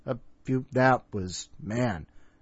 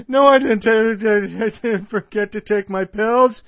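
loud laughing protest; 7 semitones higher, 8 dB louder than usual. Real, not acted. Host joking about pills.

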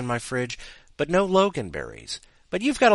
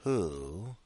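soft, lower, drawn-out word; slow question; 4 semitones lower, 10 dB quieter than usual. Acted. Bow-tie man to the remaining three, asking slowly. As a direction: ask it slowly and let it hang. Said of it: "he asked slowly".

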